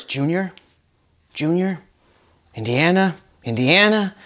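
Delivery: soft, hushed calling of a name; imitated whisper. Preacher imitating the dad whispering to Indiana, hushed. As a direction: gently whisper the name, close by. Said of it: "the power of the whisper".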